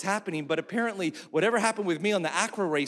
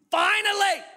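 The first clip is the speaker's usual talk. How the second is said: much higher, louder cry of relief; exultant shout; 5 dB louder than usual. Real, not acted. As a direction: shout it like it's finally over. Relief story climax.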